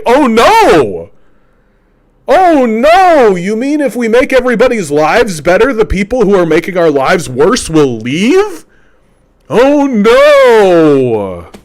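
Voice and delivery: high, hammed-up fake panic; sarcastic mock dismay at the rich leaving; mock alarm.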